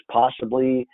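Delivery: normal talking voice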